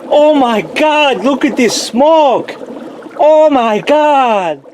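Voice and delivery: put-on high, slow Russian-accented amazement; mock-Russian astonishment, stretched out. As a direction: cry out in hammed-up awe with a Russian accent. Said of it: "the best of my Russian accent".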